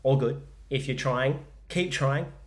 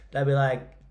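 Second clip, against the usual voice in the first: ordinary delivery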